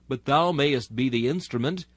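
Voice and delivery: ordinary talk, nothing marked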